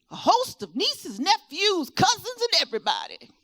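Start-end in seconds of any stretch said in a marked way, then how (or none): none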